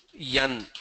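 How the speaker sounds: "yan" for N, y glide in front; N with an added y at the start; South Indian letter N. Shown as a demonstration. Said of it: "This is pronounced as".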